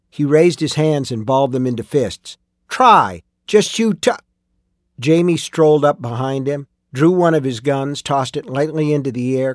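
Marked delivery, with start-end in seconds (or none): 2.7-4.1 loud menacing dare; threatening dare. Acted. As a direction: dare him to try. Miner threatens, cut off.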